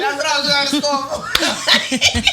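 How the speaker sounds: high, loud laughing talk. Real, not acted. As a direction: laugh as you admit it.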